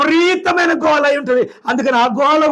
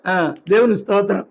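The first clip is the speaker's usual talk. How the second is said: ordinary talk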